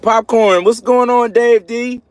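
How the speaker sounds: high, loud hail to a friend; excited greeting. Real, not acted. Hailing a viewer who just joined.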